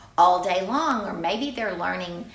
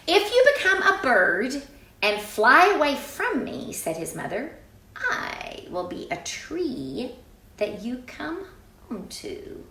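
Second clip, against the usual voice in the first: story narration; 6 semitones higher, 3 dB louder than usual. A narrator's reading. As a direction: read it like a promise at bedtime. Reading the mother's reply aloud.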